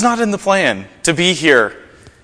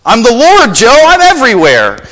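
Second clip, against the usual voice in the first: raised, louder announcing voice; voice of the Lord; 7 semitones higher, 5 dB louder than usual. Retold or imitated. The Lord telling Joe where he is, raised. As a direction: announce yourself grandly. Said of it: "the voice says".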